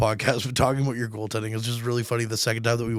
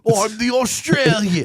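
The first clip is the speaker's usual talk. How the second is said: mock Statham; 7 semitones higher, 3 dB louder, slower than usual.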